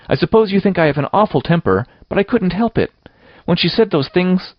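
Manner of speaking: put-on high-pitched, plaintive girl's voice; wailing self-defense. A narrator's reading. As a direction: plead your case, voice climbing high. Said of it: "wailed Anne".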